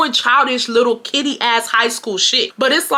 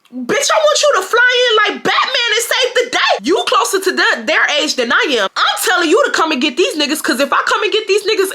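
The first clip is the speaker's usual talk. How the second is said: high-pitched re-enacted telling-off; worked-up, indignant retort, acted out; exasperated rant; 9 semitones higher than usual. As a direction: go off on your brother, pitch way up. Acted angry reply to her brother.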